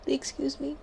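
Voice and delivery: mock indignant. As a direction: squeak Excuse me up high, playfully offended. Scolding Fizz to use command.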